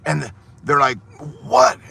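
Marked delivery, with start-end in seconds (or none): 1.4-1.8 annoyed what. Acted. Farmer couple answering door.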